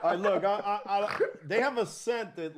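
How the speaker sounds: laughing through the words. Real, not acted. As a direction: talk while cracking up. Laughing about baby scent.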